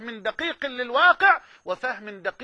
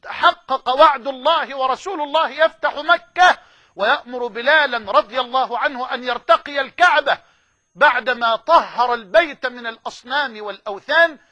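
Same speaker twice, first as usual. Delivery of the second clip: loud, rising fervor; fervent preaching; 2 semitones higher, 7 dB louder than usual. Real, not acted. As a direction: proclaim it with triumph. Preacher hails the fulfilled promise.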